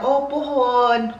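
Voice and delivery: put-on high, very slow childlike drawl; little niece. Niece answering her uncle, slow and childlike. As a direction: stretch it out like a small child. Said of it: "Sabi niya".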